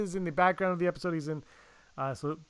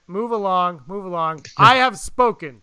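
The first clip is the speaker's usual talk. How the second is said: louder, higher, commanding bounty hunter; movie bounty hunter voice; 4 semitones higher, 10 dB louder than usual. Acted. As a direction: bark orders like an armored hunter. Quoting bounty hunter lines.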